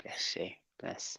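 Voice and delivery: a soft, unvoiced bless you under the breath; whispered bless you. Real, not acted. Host whispers to guest who sneezed, softly unvoiced. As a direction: breathe it out without voice, not to interrupt. Sneeze during live chat.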